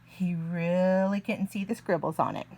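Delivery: usual speaking voice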